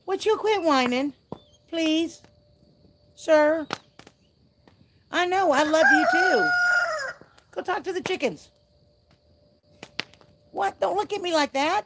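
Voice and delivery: high sing-song voice for the dog; pet talk to dog. Real, not acted. Talking to her whining dog.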